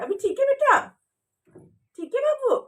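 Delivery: high sing-song reassurance to a little one. Real, not acted. Comforting the little girl.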